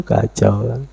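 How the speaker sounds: ordinary delivery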